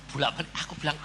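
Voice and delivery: normal talking voice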